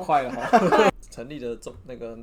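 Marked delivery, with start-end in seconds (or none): 0.5-1.0 laughing. Real, not acted. Short burst of laughter.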